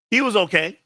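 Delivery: high, loud half-laughing exclaim. Real, not acted. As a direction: blurt it out. Reacting to chat comment.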